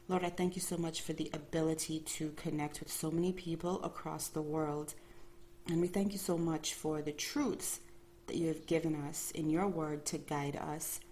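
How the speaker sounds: normal talking voice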